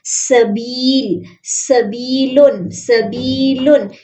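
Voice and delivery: सबीलुन very slowly, बी held long; slow madd. Shown as a demonstration. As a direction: stretch बी in सबीलुन. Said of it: "या मद्दा है". (सबीलुन धीरे, बी लंबा)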